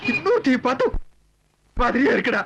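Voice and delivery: tearful wail, breaking upward; childlike crying. Acted. Husband complaining to his companion about home, childlike crying. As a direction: complain through sobs like a child. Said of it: "சின்ன பிள்ளை மாதிரி அழுதுகிட்டு".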